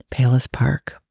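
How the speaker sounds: dramatic unvoiced whisper; whispered reveal. Co-host revealing the destination to listeners, whispering. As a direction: hush it like a secret answer.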